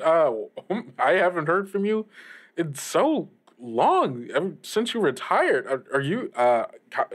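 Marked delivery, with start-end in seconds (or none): none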